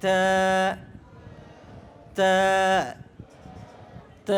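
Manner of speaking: তা with a light, fronted "ae" vowel, like "tae"; thin তা. Shown as a demonstration. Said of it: "মোটা হবে না".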